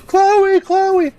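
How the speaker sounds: high yelled calls of the dog's name; screaming for the dog. Retold or imitated. Owner calling the runaway dog in the backyards, high yelled calls. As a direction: call the lost dog loudly into the dark. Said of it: "We're screaming".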